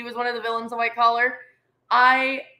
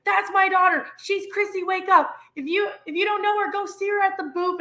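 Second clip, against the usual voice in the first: high mock yell, not full volume; retold screaming; 7 semitones higher than usual. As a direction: imitate a dad hollering proudly, pitched way up. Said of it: "And he's, like, screaming".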